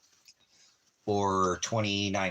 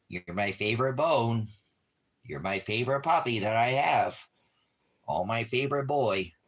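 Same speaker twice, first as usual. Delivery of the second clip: normal talking voice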